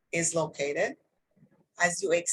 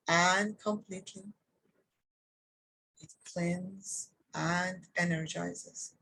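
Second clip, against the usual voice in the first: guided meditation. Acted. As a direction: guide them gently downward, phrase by phrase, with long pauses.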